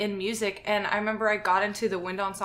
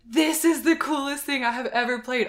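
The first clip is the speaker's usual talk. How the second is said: raised, awed exclamation; amazed; 5 semitones higher, 6 dB louder than usual. Retold or imitated. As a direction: marvel at it out loud. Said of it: "And I was like".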